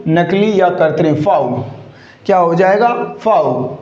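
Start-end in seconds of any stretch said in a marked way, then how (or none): 1.2-1.6 फाव as a single falling "faav"; correct pronunciation. Shown as a demonstration. Teacher introducing a word to students, फाव as one low syllable. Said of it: "इसका correct pronunciation क्या हो जाएगा". 3.2-3.8 फाव as a single falling "faav"; correct pronunciation. Shown as a demonstration. Teacher introducing a word to students, फाव as one low syllable. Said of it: "इसका correct pronunciation क्या हो जाएगा".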